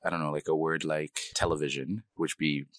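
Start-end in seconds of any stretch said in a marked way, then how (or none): none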